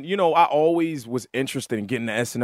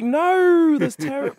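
mock outburst; 5 dB louder, slower than usual. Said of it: "I was like".

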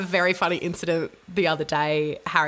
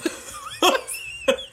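airy burst of laughter; breathless laugh; 11 semitones higher than usual. Real, not acted. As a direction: laugh it out silently. Mother laughing after toddler quote.

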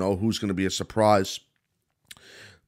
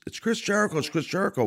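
mock announcers' yelling; 10 semitones higher, faster than usual. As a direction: imitate excited announcers in a high, held-back voice. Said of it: "are yelling that it's".

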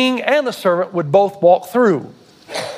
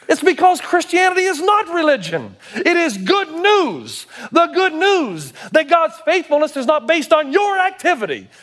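high, ringing pulpit proclamation; emphatic, high-pitched preacher voice; impassioned preaching; 7 semitones higher than usual. Real, not acted. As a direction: proclaim good news with fervor. Preaches at full intensity.

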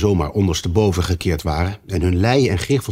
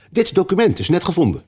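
rabbit voice; 10 semitones higher than usual. Acted. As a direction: burst in eagerly.

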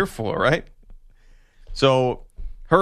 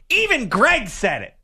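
higher, insistent quote; mother-in-law voice; 9 semitones higher than usual. Retold or imitated. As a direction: press the point with a higher pitch. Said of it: "it became this".

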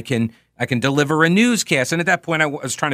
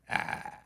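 soft creaky "ah" held in the throat; vocal fry creak; 17 dB quieter than usual. Shown as a demonstration. Host showing what the throat does, creaky fry. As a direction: let out a quiet rattling fry. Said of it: "it's like this".

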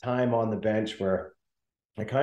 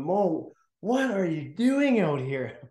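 higher, slow coach scolding; mock coach yell; 7 semitones higher, slower than usual. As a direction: play the coach calling him out, slowly. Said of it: "he'd blow the drill down".

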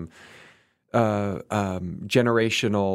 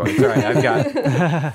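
laughing apology; words breaking into laughter; 7 semitones higher, 8 dB louder, slower than usual. Real, not acted. Laughing at shared coughing.

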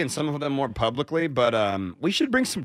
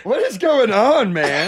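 loud outburst; 9 semitones higher, 9 dB louder, slower than usual. Real, not acted. Outraged about drinking remark.

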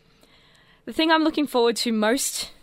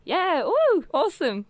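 excited cheer; 8 semitones higher, 4 dB louder, slower than usual. Real, not acted. Cheering Billie Kay.

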